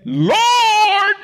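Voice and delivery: high, drawn-out plea across water; calling out. Acted. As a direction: call out long and high to someone far off. Acts Peter calling across the storm.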